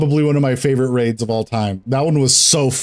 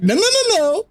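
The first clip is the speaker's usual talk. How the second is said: much higher, urgent no's; panicked high-pitched no's; frantic protest. Retold or imitated. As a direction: squeal it in panic. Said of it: "I'd be like".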